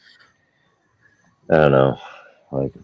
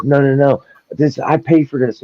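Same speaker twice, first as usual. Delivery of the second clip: mock whiny protest; 12 semitones higher, 5 dB louder than usual. Driver protesting to the cop.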